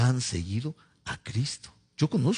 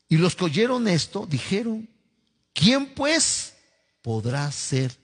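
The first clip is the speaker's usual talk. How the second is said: high, emphatic scripture reading with long pauses; lectura bíblica; 4 semitones higher, slower than usual. Acted. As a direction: launch each phrase high, then let it sink. Reads scripture aloud. (lectura enfática y pausada de la Biblia)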